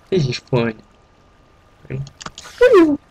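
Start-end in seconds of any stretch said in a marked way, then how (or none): 2.6-3.0 high gleeful whoop; excited whoop. Real, not acted. Excited cheer.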